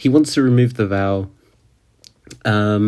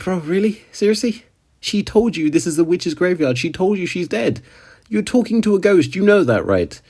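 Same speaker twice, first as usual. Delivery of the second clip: exasperated disbelief; 8 semitones higher than usual. Real, not acted. Exasperated at Subaru.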